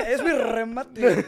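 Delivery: slow, giggly, drawn-out punchline; laughing. Real, not acted. Laughing while defending her joke to friends. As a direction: laugh through it as you land the joke.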